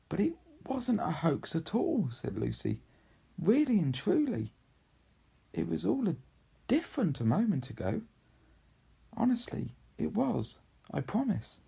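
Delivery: put-on high, urgent pleading child; pleading girl. Lucy begging her siblings to believe her, high and urgent. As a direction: beg them to believe you.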